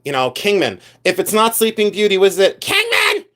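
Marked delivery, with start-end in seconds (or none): 2.6-3.2 very high-pitched parody shriek; mock scream. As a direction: squeal the name like an excitable reseller. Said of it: "they scream".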